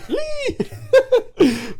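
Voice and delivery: high burst of laughter. Real, not acted. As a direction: crack up. Laughing at joke.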